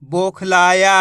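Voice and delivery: "बोखलाया" joined up, loud, vowels stretched; blended word, drawn out. Shown as a demonstration. Teacher joins the letters for learners, drawn-out blended word. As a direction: draw out "बोखलाया" as one word. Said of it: "तो मिलके बन जाएगा".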